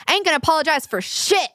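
high, sassy imitation of the other woman; mock defiant refusal. Retold defiant refusal in quarrel.

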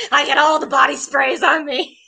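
laughing mock Elzar boast.

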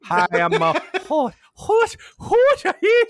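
very high, hammed-up Irish leprechaun; bad Irish accent, high and giggly. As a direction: squeal it like a cartoon Irish leprechaun.

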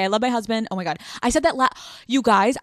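usual speaking voice

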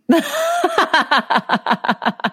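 high, delighted laugh; real amused laugh; burst of laughter. Real, not acted. Laughing at son's blunt line.

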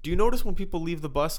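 ordinary delivery

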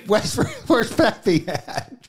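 slow, lightly put-on backwoods drawl; light hillbilly voice. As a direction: call out for Pappy like a country cousin. Voicing hillbilly for ferret law.

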